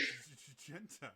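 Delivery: normal talking voice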